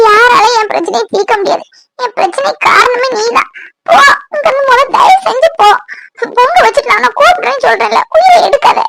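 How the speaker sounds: high, tearful outburst; angry and crying. Acted. Woman orders him to leave her alone, tearful anger. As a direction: lash out through tears, pitch climbing. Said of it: "திடீர்னு கோவப்படுற, திடீர்னு அழுற".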